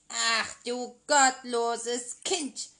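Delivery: outraged sorceress calling out; witch crying out. Acted. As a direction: scold the wicked child with a cry. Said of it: "rief die Zauberin".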